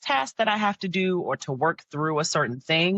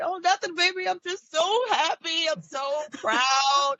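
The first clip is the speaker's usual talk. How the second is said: teary mock reply; 13 semitones higher than usual. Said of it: "Mommy's pretending to cry".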